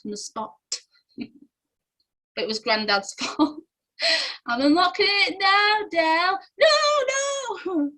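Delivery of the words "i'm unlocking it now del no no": high, loud imitated warning cry; mock panicked shouting. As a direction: call out higher, building to a stretched 'no'. Acts out the chandelier scene.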